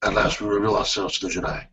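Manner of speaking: low, hushed film-villain voice; Darth Maul. Acted. Sith revealing himself to the Jedi, low quiet villain voice. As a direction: quote it deep and soft like a Sith. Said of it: "you sound like Darth Maul".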